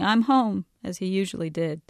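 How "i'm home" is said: a hearty, louder call home; mild bellow. A narrator's reading. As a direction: call out jovially to the house.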